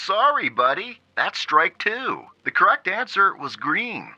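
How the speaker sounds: lightly voiced chirpy game show host; a hint of a smug quizmaster; cheery announcer. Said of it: "the announcer in his cheery voice said".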